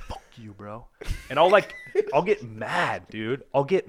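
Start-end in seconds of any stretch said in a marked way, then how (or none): 0.1-0.9 very soft, low under-breath curse; quiet curse to himself; muttered curse. Retold or imitated. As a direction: mutter it at your feet. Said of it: "and I'll just go".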